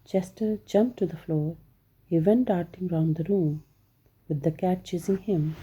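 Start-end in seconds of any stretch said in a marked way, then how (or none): none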